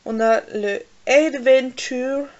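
slow, careful English-accent Adventure; mock English accent. Real, not acted. Beauty vlogger naming a swatch, in an English accent. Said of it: "avec mon accent magnifique".

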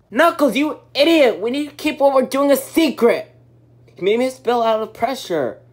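furious scolding shout; angry yelling. Acted. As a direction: yell at him in anger. Scolding a friend.

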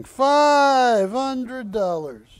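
high, loud, drawn-out call; loud auction call. Pretend. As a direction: belt out the price slowly. Auctioneer calls the price.

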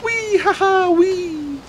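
very high squealing "Wee" and giggles; mock little girl. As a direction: squeal like a girl frolicking through the fields. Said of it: "like a little girl just, like".

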